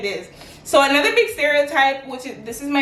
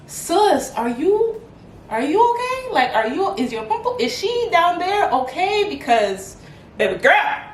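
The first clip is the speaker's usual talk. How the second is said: mock alarmed concern; 5 semitones higher than usual. Acting out concerned friend, laughing.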